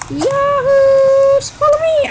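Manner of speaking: player whooping to the others, high and loud; high-pitched gleeful holler; excited whoop. Real, not acted. Calling friends to follow in the game, excited whoop.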